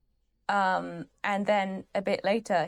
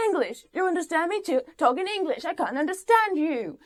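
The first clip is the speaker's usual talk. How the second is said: high, louder imitation of a bullying interrogation; mock yelling; 9 semitones higher, 4 dB louder than usual. Hopper shouting at Russians who speak no English, mock yelling. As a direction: yell it high, imitating an angry demand. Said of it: "he yells at the Russian people".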